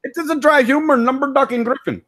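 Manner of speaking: high voice, deadpan and noncommittal; Vishnu voice. Acted. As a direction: play the dry, deadpan shopkeeper. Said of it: "my favorite one to do is Vishnu".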